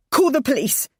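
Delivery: hoarse, urgent plea. Acted. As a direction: rasp it out urgently. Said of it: "I said hoarsely".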